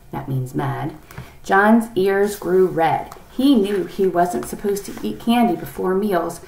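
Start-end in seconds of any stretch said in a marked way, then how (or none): none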